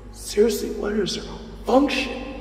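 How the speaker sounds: high, incredulous inner rant; can't believe this girl; exasperated disbelief. Acted. Hero watching the reckless hostage, incredulous and exasperated.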